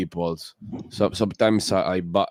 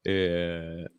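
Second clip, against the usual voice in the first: ordinary delivery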